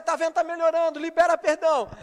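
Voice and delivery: high, loud reenacted encouragement; excited urging. Pastor urging husband to release forgiveness, excited and loud. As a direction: cheer him on to forgive.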